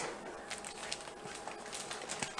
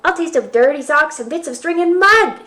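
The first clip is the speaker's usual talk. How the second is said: put-on loud, higher boy's shout; 7 semitones higher, 8 dB louder than usual. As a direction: shout it in protest. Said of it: "shouted Jack".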